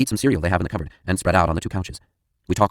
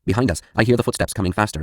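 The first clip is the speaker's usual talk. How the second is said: ordinary delivery, nothing marked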